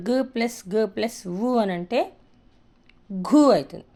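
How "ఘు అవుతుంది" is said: ordinary delivery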